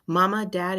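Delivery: ordinary delivery, nothing marked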